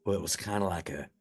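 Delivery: normal talking voice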